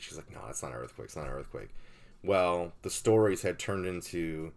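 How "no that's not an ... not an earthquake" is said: lower, softer mock wife voice; a hint of the wife brushing it off; wife's dismissive voice. Wife voiced in retold story.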